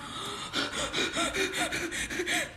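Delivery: long high airy gasp; shocked intake of breath. Real, not acted. Overwhelmed by cards.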